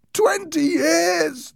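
very high, long-drawn wail; crying ghost voice. A narrator's reading. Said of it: "the voice cried".

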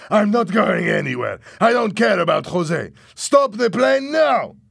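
loud, high defiant shouting; shouted refusal. A narrator's reading. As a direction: dig in and shout them down. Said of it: "Louis suddenly shouted".

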